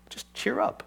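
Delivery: breathy whispered brush-off; mock whisper. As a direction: whisper the useless advice. Pastor mocks bad counsel.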